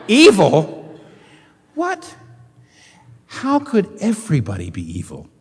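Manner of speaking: high-pitched, incredulous echo of an accusation; shocked disbelief. Acted. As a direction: act out a stunned listener, pitch jumping up. Acts out listeners' shocked reaction.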